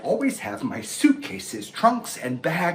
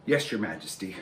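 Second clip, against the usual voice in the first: soft lower meek answer; timid reply; 6 semitones lower, 7 dB quieter, faster than usual. Acted. Frightened animal answering the lion, soft and meek. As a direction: cower before the king. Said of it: "everyone was so afraid".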